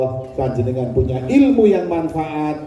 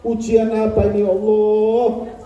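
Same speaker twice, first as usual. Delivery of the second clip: tearful prayer; 5 semitones higher than usual. Retold or imitated. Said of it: "setelah sholat nangis".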